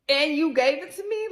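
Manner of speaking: high, loud disbelieving question; accusing the ex in disbelief, high and loud; incredulous. Retold or imitated. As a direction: throw it at him, outraged. Imagined accusation of ex.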